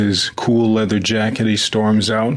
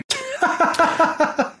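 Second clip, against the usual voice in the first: laugh. Real, not acted. Laughing at the movie recap.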